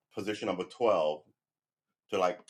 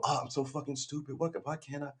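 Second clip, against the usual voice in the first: a higher, self-hating whine; mock self-loathing, pitched up; inner critic; 6 semitones higher, 3 dB quieter than usual. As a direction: play your own harsh inner critic, a touch higher. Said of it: "you should never go".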